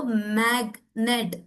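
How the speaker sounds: मैग्नेट split into mag, then net; syllable by syllable. Shown as a demonstration. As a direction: break मैग्नेट in two, sounding the g. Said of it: "two syllable word है". (मैग्नेट को मैग - नेट तोड़कर)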